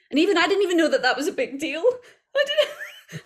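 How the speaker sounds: high, quick and breaking into laughter; laughing. Real, not acted. Actor confesses she missed the big deal, laughing. Laughs at her own naivety.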